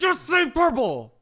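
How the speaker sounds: high, slow, drawn-out cry; exasperated outburst. Retold or imitated. Acting out fed-up reply to indigo, exasperated cry. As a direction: burst out as if fed up with the word.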